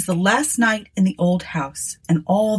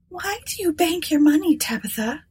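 higher, timid younger sister voice; 10 semitones higher than usual. A narrator's reading. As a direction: voice Eunice as a nervous woman. Eunice voiced in story.

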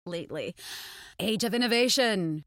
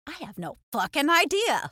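exasperated; 6 semitones higher, 4 dB louder than usual. Retold or imitated. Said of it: "And I was like".

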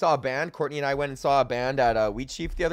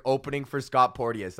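ordinary delivery

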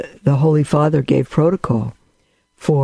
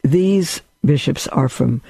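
ordinary talk, nothing marked

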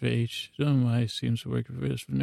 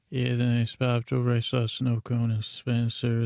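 ordinary delivery

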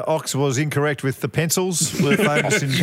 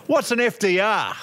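broad Aussie twang, pitched up; hammed-up Australian accent, raised pitch; ocker Australian; 5 semitones higher than usual. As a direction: ask it like a real ocker Australian. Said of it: "in a real locker Australian voice".

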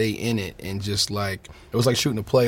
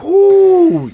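loud, drawn-out high "ooh"; high whoop from the heat; 13 dB louder than usual. Real, not acted. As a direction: let out a long whoop at the burning spice. Reacting to very hot sauce.

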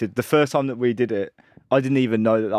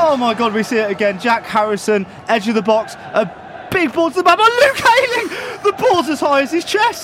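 higher, louder replay commentary; excited replay call; 6 dB louder than usual. Pretend. Replay commentary, rising again.

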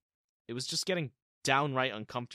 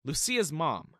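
usual speaking voice

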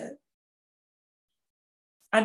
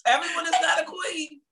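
higher, louder insistent repeat; emphatic repeat; 9 semitones higher, 4 dB louder than usual. Real, not acted. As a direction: insist over her.

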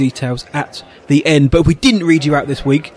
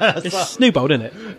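laughing; 4 semitones higher, 4 dB quieter, slower than usual. Real, not acted. Laughing while saying it.

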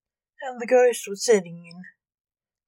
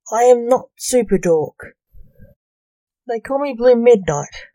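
offended; 3 semitones higher, 6 dB louder than usual. A narrator's reading.

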